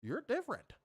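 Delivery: soft, high, mock-surprised voice; hushed high-pitched condescension. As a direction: imitate a quietly amazed acquaintance. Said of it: "they be like".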